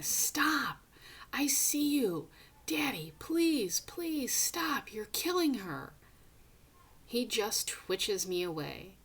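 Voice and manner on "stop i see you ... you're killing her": high, loud cry begging him to stop; desperate pleading shout. Acted. Boy screaming at the father to stop killing her. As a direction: scream it at him, breaking into a plea. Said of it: "I'm going".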